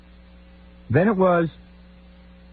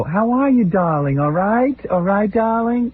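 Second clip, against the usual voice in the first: mother-in-law voice; 6 semitones higher, slower than usual. Retold or imitated. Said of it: "all she'll ever do to interact with me is go".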